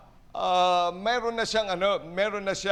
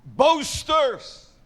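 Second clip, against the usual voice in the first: loud proclaiming; 5 semitones higher, 5 dB louder than usual. Acted. Preacher proclaims verse.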